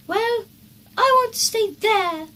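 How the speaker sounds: put-on a boy insisting on what he wants; child voice begging to stay at the noisy campsite; child's voice. A narrator's reading. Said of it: "said Peter".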